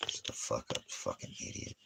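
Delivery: unvoiced under-breath insult; whispered insult. Real, not acted. Vlogger whispering an insult aside, unvoiced. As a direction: hiss it under your breath.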